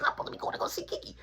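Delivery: fast, squeezed whisper; hushed aside. Retold or imitated. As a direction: rush it out under the breath. Repeats it hushed, mimicking himself.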